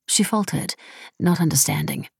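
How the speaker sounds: usual speaking voice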